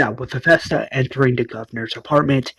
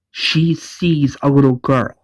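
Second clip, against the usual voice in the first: normal talking voice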